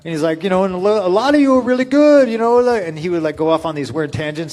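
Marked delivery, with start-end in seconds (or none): 0.4-2.9 mimicking Tony Atlas. Tony Atlas voiced in story.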